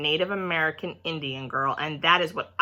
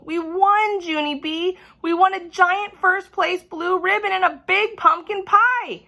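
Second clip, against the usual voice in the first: much higher, loud and elated; happy cheering; 12 semitones higher, 5 dB louder than usual. A narrator's reading. Herbert cheering with Junie, high loud joy. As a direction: squeal the good news.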